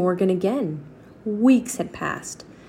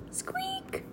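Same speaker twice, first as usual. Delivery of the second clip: high squeak; 15 dB quieter than usual. Acted. Mouse squeak imitated.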